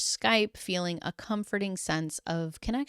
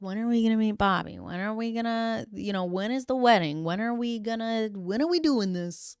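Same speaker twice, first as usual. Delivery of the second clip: lightly hammed-up nagging questions, pitched higher; imitating impatient friends and family, higher voice; mock impatient pestering; 4 semitones higher, 3 dB louder than usual. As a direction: pester like eager relatives, a bit mockingly. Acts out relatives pestering about wedding.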